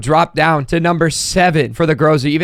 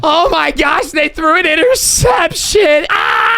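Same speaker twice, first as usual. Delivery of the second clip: high, slow yell of disbelief; shocked shout; 10 semitones higher, slower than usual. Real, not acted. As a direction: cry out in shock. Team throws an interception.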